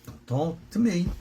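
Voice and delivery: usual speaking voice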